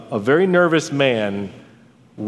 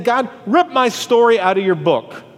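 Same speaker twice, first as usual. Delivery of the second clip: higher, impassioned plea to God; anguished demand; 8 semitones higher than usual. Acted. Preacher voicing Job begging God for erasure, anguished. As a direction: demand it desperately, pitched up.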